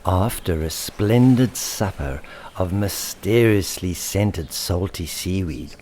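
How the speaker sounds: plain reading voice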